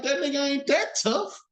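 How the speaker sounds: high, dismissive street talk; casual street paraphrase. Retold or imitated. Preacher voicing the soldiers about Goliath, high and dismissive. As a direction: wave off Goliath like a local tough. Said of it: "He like".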